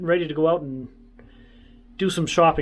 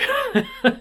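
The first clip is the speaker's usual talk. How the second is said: quick amused laugh; a burst of real laughter; short laugh. Real, not acted. Laughs at being put to work.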